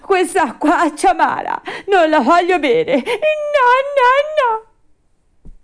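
high, loud tantrum wailing; bursting into tears. A narrator's reading. Puppet refuses the bitter water, wailing in tears. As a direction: bawl it out like a crying child. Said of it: "dando in uno scoppio di pianto".

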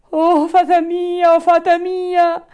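high, loud, drawn-out wail; panicked shriek. A narrator's reading. Puppet calls desperately to the fairy, shrieking. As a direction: shriek for help like a terrified puppet. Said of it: "cominciò allora a strillare il burattino".

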